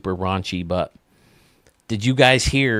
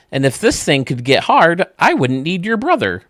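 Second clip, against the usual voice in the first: high, louder sassy wife; 8 semitones higher, 5 dB louder than usual. Acted. Wife answering husband's jokes, high sassy punchline. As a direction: deliver the comeback high and loud.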